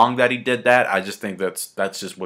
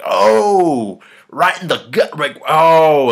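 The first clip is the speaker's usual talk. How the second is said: loud, drawn-out emotional "oh"; mock gut-punched; 6 semitones higher, 7 dB louder, much slower than usual. Fan hit by the panel of the Fallen, overwhelmed.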